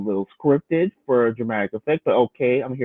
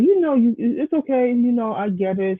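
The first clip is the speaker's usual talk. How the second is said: lightly voiced Zari, higher, a mild soothing tone; a hint of her, pitched higher, gently; imitating Zari; 10 semitones higher than usual. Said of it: "And she's like".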